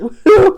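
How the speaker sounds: loud, high burst of laughter; real explosive cackle. Real, not acted. Laughing at her own joke.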